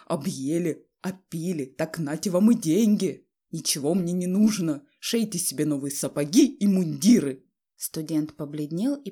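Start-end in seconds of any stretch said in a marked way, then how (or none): 0.1-7.4 from muttering rage to loud outburst; growing fury. A narrator's reading. Father throwing money at his family, mounting fury. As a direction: build from a mutter into bitter shouting. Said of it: "свирепея всё более, произнося слова одно другого ужаснее".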